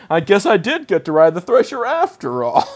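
hammed-up kid's closing zinger; mock little kid. Host acts the kid ending the episode, mock kid voice.

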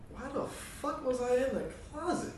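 high-pitched mock disbelief; baffled reaction. Man finding himself in his closet, high and baffled. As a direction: squeak the question up high, baffled.

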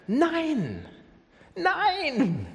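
high, forceful double no; Jesus nearly yelling his refusal; near shout. Retold or imitated. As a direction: cry out the no almost shouting. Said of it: "Er schreit das fast raus".

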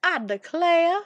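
playful Southern "I declare", rising; Southern belle. Pretend. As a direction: say it like a Southern lady. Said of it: "I dare say".

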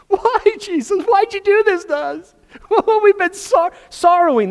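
high hammed-up tearful whine; mock sobbing mother. Mary finding Jesus, hammed-up high tearful whine. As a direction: overplay a weeping mother.

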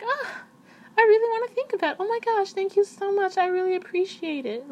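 put-on baby voice. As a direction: coo your thanks up high to a crush. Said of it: "imagine talking to a guy like that".

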